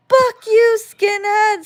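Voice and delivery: high, loud bratty scream; kid's yelled taunt. Retold or imitated. Retelling the little cousin screaming at her, high bratty yell. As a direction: shriek it like a spitting little brat. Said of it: "he'd be like".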